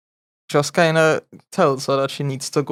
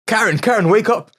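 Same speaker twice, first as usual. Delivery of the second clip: urgent husband; 7 semitones higher, 4 dB louder than usual. Retold or imitated. Bob waking his wife urgently.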